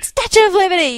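loud, high shout of recognition; excited shout. Retold or imitated. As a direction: yell it as if you just spotted it. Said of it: "I'm going".

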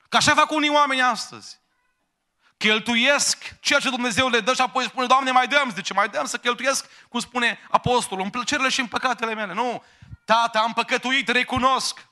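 impassioned pulpit climax; preaching at full force. Acted. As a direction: lift the whole hall with urgent pleading. Preacher climaxes on the prodigal son.

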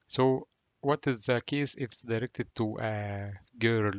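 normal talking voice

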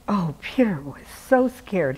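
ordinary delivery, nothing marked

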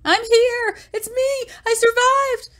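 very high, squealing call; high-pitched, excited acted cry. A narrator's reading. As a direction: call out that you are alive. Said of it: "they heard a muffled cry".

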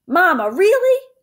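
can't stand the wait; exasperated. Acted. Boy exasperated at Mama's stalling, high loud whine.

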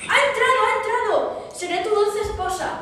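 shrieked high and loud; triumphant shriek. A narrator's reading. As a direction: screech it in greedy triumph. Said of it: "pero ella gritó más". (chillido triunfal, muy agudo y fuerte)

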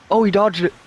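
sudden high excited yelp. Real, not acted. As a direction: jump up in pitch, thrilled. Gamer reacting to a dodge.

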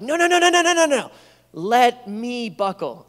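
put-on high, loud string of no's from a child; frantic toddler. Father imitating his daughter refusing help with the buckle, high and loud. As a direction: protest like a stubborn toddler at a car seat.

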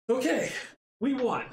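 high, loud, elated; excited. Real, not acted. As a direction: cheer the surprise win. Sudden win in the game.

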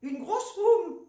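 Une grosse pomme, slow and laughing, grosse with an open o; mock the way they say grosse pomme; imitating their accent. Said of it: "Vous dites gros, grosse?".

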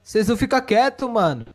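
frustrated yell. Pretend. Frustrated father yelling at others.

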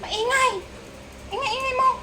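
high sing-song scolding; high pet scolding. Real, not acted.

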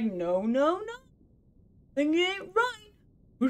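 a light mock voice for the game, slow; the game scolding her, drawn out slowly; voicing the game. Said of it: "the game's like".

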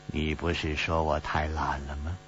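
usual speaking voice

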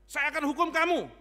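loud, high, hammed-up threat; mock hateful threat. Hater threatening to punish someone else, exaggerated vengeful shout. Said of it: "Dia benci sama orang lain".

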